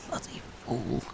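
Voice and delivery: very soft, whispery grumble; muttered aside. Acted. Player as Enid cursing the companion who ignores the shout, muttering. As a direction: mutter it under the breath.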